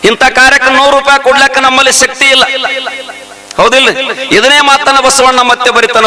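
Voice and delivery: high, ringing sermon voice; fervent village preacher; preaching at full force. Acted. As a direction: preach it out to the crowd.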